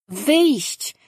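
the pet insisting on "Wyjść" again, stubbornly; insistent creature. A narrator's reading. The pet repeats "Wyjść" to Danin, refusing his no, insistent and high. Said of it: "upierał się Figiel".

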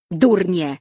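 short, loud cry of anger; angry shout. A narrator's reading. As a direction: shout the insult at the cousins. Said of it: "krzyknęła Drilli".

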